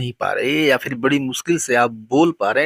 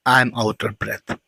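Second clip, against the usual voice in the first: very slow, lower model sentence; slow soft model sentence; 4 semitones lower, much slower than usual. Shown as a demonstration. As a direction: repeat it slowly for learners. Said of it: "Literally इसको हम translate करते हैं". (धीमे, नीचे स्वर में दोहराया वाक्य)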